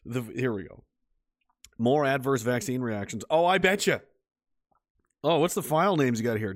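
ordinary talk, nothing marked

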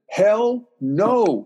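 "hell no" pitched high and drawn out; emphatic refusal. Retold or imitated. Friend telling Tom not to run, emphatic. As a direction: land "hell" and "no" as two weighty words.

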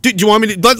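usual speaking voice

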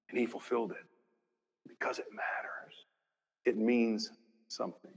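dropping to a whisper; soft whispered emphasis; hushed. Real, not acted. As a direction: whisper it like a secret. Preacher drops to hush.

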